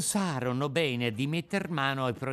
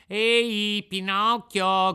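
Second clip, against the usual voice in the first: put-on loud high call of the eldest boy; big bully yelling, drawn-out name; shout; 8 semitones higher, 9 dB louder, much slower than usual. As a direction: bellow his name across the beach. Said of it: "gridò allora il più grande".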